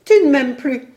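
high, loud, distressed accusation; panting distress. A narrator's reading. Young woman accuses her lover, panting in distress. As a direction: burst it out as if gasping. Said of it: "haletait la jeune femme".

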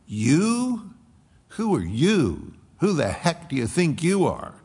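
emphatic demon. Acted. Demon challenging the sons of Sceva, emphatic and sneering. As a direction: fling it back at them with contempt. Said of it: "it's emphatic".

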